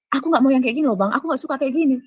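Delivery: retold firm protest. Woman refuses the man's advances, protesting.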